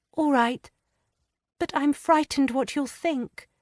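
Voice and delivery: plain reading voice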